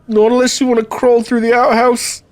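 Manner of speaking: a hint of a cornered guard; guard's reply. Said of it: "he's gonna say".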